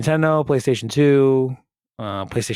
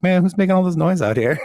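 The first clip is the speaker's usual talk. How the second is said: higher, mildly irritated mock mom; lightly voiced annoyed mom; 7 semitones higher, 3 dB louder than usual. Co-host playing friend's mom woken by banging, mildly annoyed. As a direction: lightly play a woken-up parent complaining. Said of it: "She's like".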